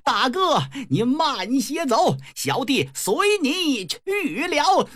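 high, drawn-out theatrical wail; flustered cry. Acted. Brother in panic shouts the wrong vow, high drawn-out wail. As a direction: cry it out high, stretching to a climax. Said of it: "一着急还喊错了".

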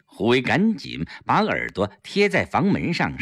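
ordinary delivery

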